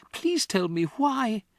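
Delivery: put-on begging girl. Matilda begs teacher.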